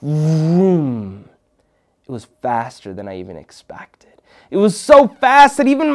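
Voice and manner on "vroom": long, drawn-out engine rev; imitated engine vroom. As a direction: imitate a toy car speeding off. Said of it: "it went vroom".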